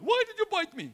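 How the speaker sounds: squeaky, high, indignant mock yelp; mock victim cry. People bitten by their pet Satan, high mock squeal. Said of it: "And then they went".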